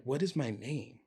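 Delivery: hushed to yourself; inner panic, quiet. Retold or imitated. Lost in his own head, asking himself his name softly. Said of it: "thinking in my head".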